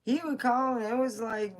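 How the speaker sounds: normal talking voice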